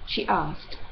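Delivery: plain reading voice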